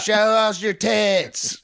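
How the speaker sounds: high, slow mock yell of a drunk heckler. Imitating a heckler shouting at the comic on stage. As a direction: stretch it out like a rowdy woman shouting from the back. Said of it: "She yelled".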